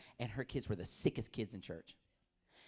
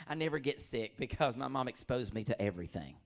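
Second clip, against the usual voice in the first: ordinary delivery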